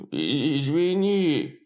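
slow, drawn-out moan; moaned apology. Acted. Terrified neighbor moans an apology to Clyde. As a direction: groan the word in fear. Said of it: "простонала госпожа Грейсон".

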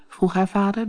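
ordinary delivery, nothing marked

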